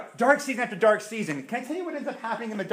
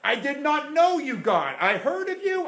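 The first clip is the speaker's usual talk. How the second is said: high, louder pleading; pleading repentance; 8 semitones higher, 4 dB louder than usual. Acted. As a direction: cry out in repentance, voice rising. Job repenting to God.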